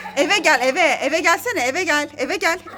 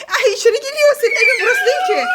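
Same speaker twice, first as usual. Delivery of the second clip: higher and louder, laughing as the dog comes in; laughing exclamation; 5 semitones higher, 4 dB louder, faster than usual. Real, not acted. Mother laughing at the stray dog coming back inside, higher and louder. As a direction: burst out laughing and scold the dog.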